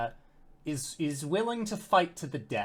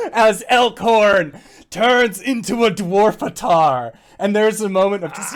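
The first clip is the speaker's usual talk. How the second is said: usual speaking voice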